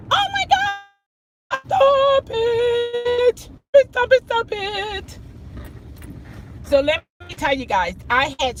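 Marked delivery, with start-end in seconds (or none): 0.1-5.2 high, drawn-out begging; exasperated pleading. Retold or imitated. Stuck on hold and missing class, exasperated. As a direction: beg the glitching screen to stop. Computer freezing during class.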